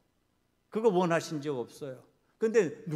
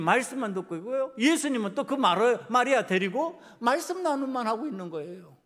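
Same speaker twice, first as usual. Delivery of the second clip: loud, raised-pitch indignant protest; angry complaint; 5 semitones higher, 9 dB louder than usual. Acted. Martha gripes to Jesus about her idle sister, angry and loud. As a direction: complain hotly, voice pushed up and loud. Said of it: "화가 나는 거예요".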